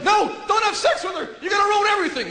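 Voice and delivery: high, shouted plea; panicked good half. Acted. Guy's decent half begging him to stop, high shout. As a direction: cry out in a high, frantic voice. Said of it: "One half's going".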